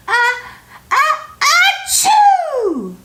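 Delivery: enormous sneeze. A narrator's reading. The bear, tickled by mouse whiskers, sneezes enormously. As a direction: wind up and let rip a huge sneeze. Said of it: "gave an enormous sneeze".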